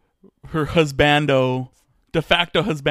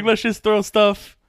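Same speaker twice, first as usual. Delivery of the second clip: mock careless writer; 5 semitones higher, 4 dB louder than usual. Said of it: "kinda like".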